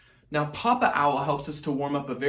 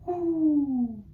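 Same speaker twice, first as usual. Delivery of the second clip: Mama Owl hoo; 12 semitones higher than usual. Shown as a demonstration. Teacher modelling Mama Owl's singing voice. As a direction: float up into a light head voice. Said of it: "sound exactly like her".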